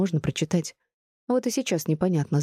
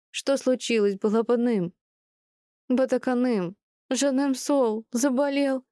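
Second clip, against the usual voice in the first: put-on high-pitched grandmotherly baby talk; doting high voice for a sick little one; cooing to toddler; 8 semitones higher, slower than usual. A narrator's reading. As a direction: coo tenderly, pitched well up.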